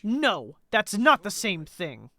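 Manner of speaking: mildly heated debate voice; lightly voiced, emphatic game figures; indignant zealot. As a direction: argue the point with theatrical insistence.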